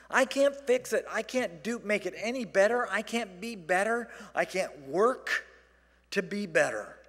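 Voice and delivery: high, loud preaching; fervent preaching. Acted. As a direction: preach it with rising fervor. Preaching at full force.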